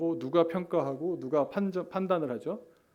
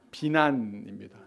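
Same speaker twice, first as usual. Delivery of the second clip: usual speaking voice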